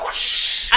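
breathy magic whoosh sound; airy whoosh of vanishing magic; whispered whoosh. Acted. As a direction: blow it away like a spell. Magic whisking away the mess.

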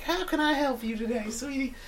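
high, soft sweet Southern voice; Southern lady. Retold or imitated. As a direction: coo it like a kindly Southern agent. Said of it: "a nice Southern lady who's like".

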